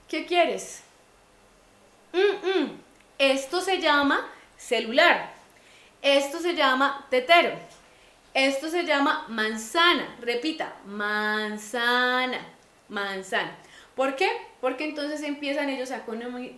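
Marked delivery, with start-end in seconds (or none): 0.1-0.8 higher, acted question to a child; adulto preguntando al niño. 2.1-2.8 high, loud "mm, mm"; imitated child's grunt reply. El niño responde sin palabras. 3.2-10.7 higher, louder teaching of each word; name each thing firmly for a small child; adulto enseñando a hablar. Acted. Adulto enseña palabras al niño. 10.9-12.5 drawn-out word model. Shown as a demonstration. Modela la palabra para repetir.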